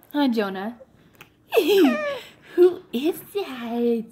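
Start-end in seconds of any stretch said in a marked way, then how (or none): 1.5-2.2 high, bright burst of laughter; delighted laugh. Real, not acted. Mother laughing with joy at her baby son.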